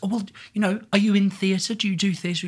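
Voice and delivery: high, sing-song camp dame. Retold or imitated. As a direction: coo it like a pantomime dame. Retold dame voicing a front-row punter.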